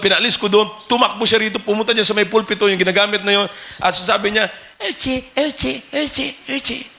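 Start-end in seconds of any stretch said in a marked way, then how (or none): 4.8-6.9 put-on soft, babbling toddler preaching; toddler mock preaching. Pastor imitating a toddler pretending to preach, soft babble. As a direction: imitate a two-year-old playing preacher, softly. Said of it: "Nagpipreach-preach kunyari".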